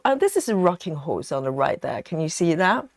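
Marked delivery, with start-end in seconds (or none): none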